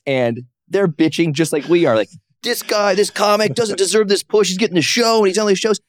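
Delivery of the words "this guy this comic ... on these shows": high, pinched mock-whine of jealous comics; whiny complaining comics. Comics griping backstage about another comic's push, in a high mock whine. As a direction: whine it like a bitter open-mic comic. Comics bitching in locker room.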